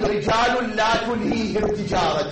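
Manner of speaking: loud, high declaiming of the verse; preacher lifts the verse high and loud. Real, not acted. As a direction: proclaim it to the hall. Preacher raises voice quoting verse.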